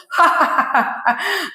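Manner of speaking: soft, high villainous chuckle; villain laugh. Acted. Nagaraju laughs like a villain at Subbalakshmi. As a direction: cackle like a film villain. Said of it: "విలన్లా నవ్వకు".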